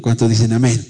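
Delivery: ordinary talk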